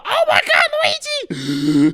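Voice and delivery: very high, loud, slow shriek; panicked Toad. Retold or imitated. Toad crying out to Luigi, very high and loud. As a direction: wail it like a frightened mushroom. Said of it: "and he's like".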